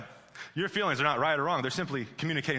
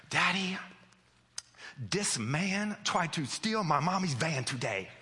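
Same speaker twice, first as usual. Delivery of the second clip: put-on slow little-girl voice telling Daddy; three-year-old daughter; 3 semitones higher, slower than usual. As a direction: imitate a toddler reporting big news slowly. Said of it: "our little daughter".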